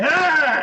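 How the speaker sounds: high, loud mock scream; short hammed-up shriek; crowd scream.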